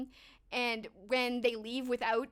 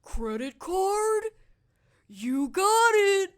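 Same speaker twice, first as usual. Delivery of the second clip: high, slow, hammed-up delivery; exaggerated movie quote; 8 semitones higher, 9 dB louder, much slower than usual. Streamer quoting Home Alone to chat, high and hammed-up. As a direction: ham it up like a ridiculous movie line. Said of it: "all done exaggerated and ridiculous".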